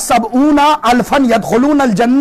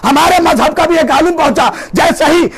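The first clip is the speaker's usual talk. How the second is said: raised, shouted sermon voice; fiery preaching; 5 semitones higher than usual. Acted. Preacher rousing his congregation with a story, shouting.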